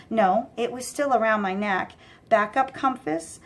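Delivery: plain reading voice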